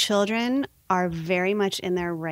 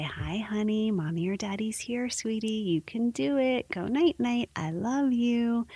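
loving calm tone; 3 semitones higher than usual. Shown as a demonstration. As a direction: soothe a crying baby gently. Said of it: "a loving, calm tone of voice to say".

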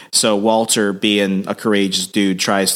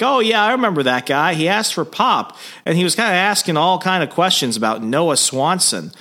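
a higher, folksy local voice; podcast host voicing a bartender in a comic recap, higher folksy local; small-town bartender; 7 semitones higher than usual. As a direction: play the chatty bar regular, lifted up.

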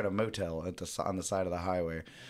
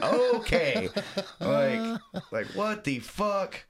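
much higher, louder stunned okay; drawn-out disbelief, pitched way up; a bewildered what the fuck; 11 semitones higher, 3 dB louder, much slower than usual. Retold or imitated. Retold stunned reaction.

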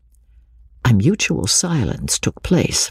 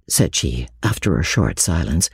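plain reading voice

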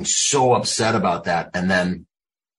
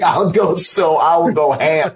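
higher and louder, pumped-up promise; fired-up vow; 9 semitones higher, 5 dB louder, slower than usual. Retold or imitated. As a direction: swear it like you're hyped for a fight. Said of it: "and I said it like this too".

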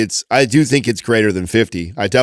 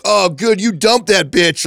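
higher, gleeful mock cheer; imitating a delighted friend, pitched up; gleeful buddy; 7 semitones higher, slower than usual. Friend on the phone cheering the breakup, in a high mock-gleeful voice. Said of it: "And he's like".

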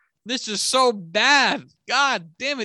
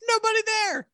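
much higher, incredulous nobody there; exasperated rise; 11 semitones higher, faster than usual. Real, not acted. Fan repeating nobody there to listeners, much higher and incredulous. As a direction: pitch it up in disbelief.

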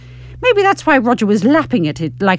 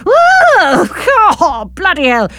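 much higher, loud shriek; 8 semitones higher, 4 dB louder, much slower than usual. A narrator's reading. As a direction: shriek in sudden fright. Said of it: "I shriek".

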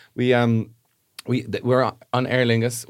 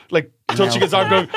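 normal talking voice

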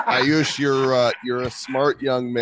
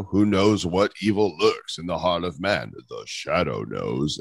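ominous radio narrator; 5 semitones lower than usual. Retold or imitated. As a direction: intone it like an old-time radio villain. Quoting old radio show intro.

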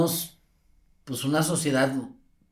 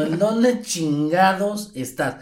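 drawn out, word by word; slow scolding mother; much slower than usual. Retold or imitated. Mother demanding her child's whereabouts, slow deliberate scolding. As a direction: spread the question out like a fed-up mom. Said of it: "las mamás te lo aplican".

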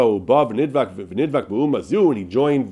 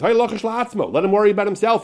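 sharp rebuke; 8 semitones higher than usual. Retold or imitated. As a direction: quote the Rambam with a sharp edge, pitched higher. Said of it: "the Rambam here gets very, very sharp".

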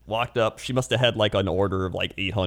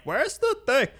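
confused shopper voice. Retold or imitated.

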